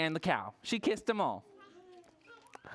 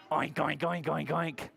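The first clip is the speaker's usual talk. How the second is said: pig oink; 4 semitones lower than usual. Shown as a demonstration. Teacher answering what the pig says, pig oinks. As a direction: run of low oinks. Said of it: "What does the pig say?".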